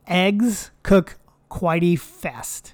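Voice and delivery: slowly drawn out. Real, not acted. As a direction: drag the words out slow. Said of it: "Quitey fast".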